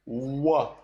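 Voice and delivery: wa said with w, not v; wa instead of va; w sound. Shown as a demonstration. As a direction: round lips for wa. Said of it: "we read W".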